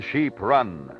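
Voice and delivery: ordinary talk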